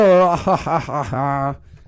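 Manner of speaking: loud, high staged ha-ha-ha; exaggerated gleeful cackle; mock hearty laugh. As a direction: laugh in character as a cartoon Scot. Laugh of the acted brother.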